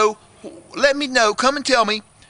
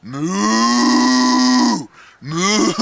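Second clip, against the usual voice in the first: long, low imitated moo; drawn-out pretend cow lowing; 10 semitones lower than usual. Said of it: "he goes".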